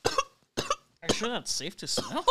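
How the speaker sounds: laughing. Real, not acted. Coughing after a sniff.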